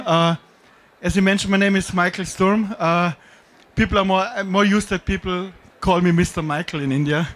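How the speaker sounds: usual speaking voice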